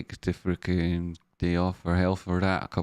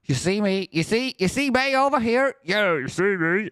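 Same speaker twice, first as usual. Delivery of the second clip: high-pitched mock taunt, rising questions; showy taunting call; 11 semitones higher than usual. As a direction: call for attention in a silly high voice. Acts out attention-seeking shouts.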